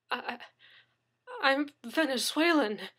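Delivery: stammering, dazed. Acted. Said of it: "Daniel stammered woozily".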